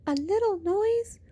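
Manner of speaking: high, small whispering rabbit. Acted. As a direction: ask it in a tiny whisper. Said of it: "whispered the rabbit".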